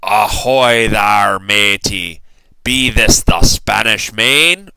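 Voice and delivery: loud, slow gruff pirate bellow; pirate yell. A narrator's reading. Head pirate hailing a boy on the beach, loud slow pirate yell. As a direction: bellow it like a salty sea captain. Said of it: "the head pirate climbed out of the boat and yelled".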